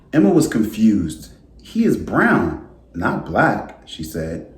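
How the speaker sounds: gentle storybook read-aloud voice; reading to children. A narrator's reading. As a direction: read it like story time for a class. Teacher reads picture book aloud.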